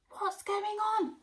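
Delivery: high, loud alarmed ask; high startled question. Acted. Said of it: "asked Aubergine".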